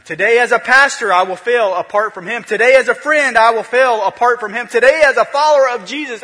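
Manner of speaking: loud, high, driving sermon cadence; full-force preaching. Acted. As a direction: hammer each line home to the congregation.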